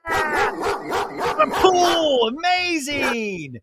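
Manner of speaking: high, slow squeal of doggy delight; happy dog voice. Pretend. The dog fresh out of the pool, high happy squeal. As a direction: gush like an overjoyed dog.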